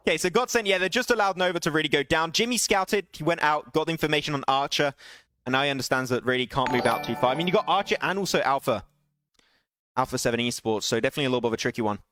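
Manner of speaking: energetic esports caster calling the action; live play-by-play. Acted. Caster calling Svan's clutch for stream viewers, live play-by-play. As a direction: narrate the fight as it happens, rising at the clutch. Casting a battle royale match.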